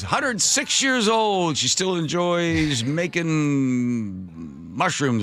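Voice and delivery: high, drawn-out birthday tribute; Willard Scott. Retold or imitated. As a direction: stretch it out slowly, starting high and sliding down. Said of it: "he was also Smucker's".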